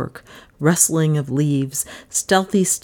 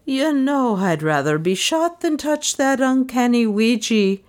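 higher doleful maid's voice; 7 semitones higher than usual. Acted. Maid Lizzie refusing the Ouija board, doleful. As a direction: moan it with dread. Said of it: "she said dolefully".

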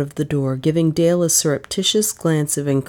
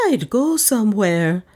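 high, woeful wail; high-pitched forlorn moan; wailing lost soul; 6 semitones higher than usual. A narrator's reading. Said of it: "she wailed in the voice of a lost soul".

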